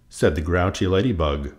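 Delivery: ordinary delivery, nothing marked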